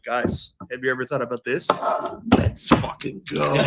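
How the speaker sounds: low voiced hype imitation; imitating Danny hyping. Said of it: "Danny'd be like".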